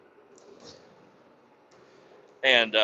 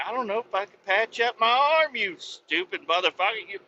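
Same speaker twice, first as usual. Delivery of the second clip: higher put-on voice, outraged; indignant rant; 7 semitones higher than usual. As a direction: scold the soldiers who shot you. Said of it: "And I'm like".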